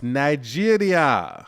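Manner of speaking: put-on loud, drawn-out Nigerian-accented flourish; mock Nigerian accent. Traveler announcing the trip to a co-host, mock Nigerian accent. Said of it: "Was that your Nigerian accent?".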